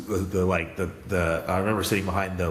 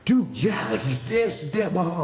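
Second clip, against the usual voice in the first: excited fans; 9 semitones higher than usual. Retold or imitated. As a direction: gush like a fan who just found out. Retold excited lifters.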